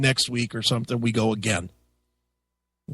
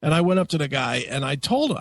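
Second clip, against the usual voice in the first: ordinary talk, nothing marked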